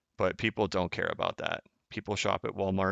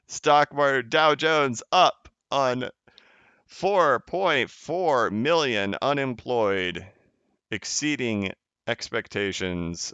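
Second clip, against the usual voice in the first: slow headline read; 4 semitones higher, 3 dB louder, much slower than usual. Real, not acted. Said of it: "reading headlines slowly".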